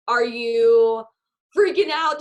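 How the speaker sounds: loud, hyped-up freaking out; excited. Acted. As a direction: pump the team up loudly. Hyping team about promotion.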